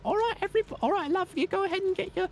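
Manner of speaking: high-pitched airport worker giving orders; British airport staff. Acted. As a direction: chivvy travellers along in a high put-on voice. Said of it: "not famously hospitable, but still like".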